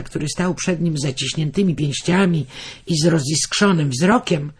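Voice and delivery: usual speaking voice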